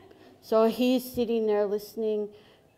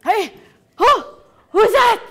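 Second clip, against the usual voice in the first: high, loud startled call; 10 semitones higher, 11 dB louder than usual. Acted. Frog Woman to whoever is knocking, startled shout. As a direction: call out sharply at a surprise knock.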